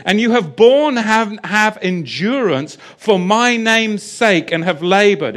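loud, measured scripture proclamation; scripture reading. Acted. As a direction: proclaim the verses slowly and forcefully. Resumes reading scripture at force.

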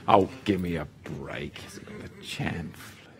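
low, soft dismissive mutter; scornful aside. Real, not acted. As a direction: brush it off under your breath.

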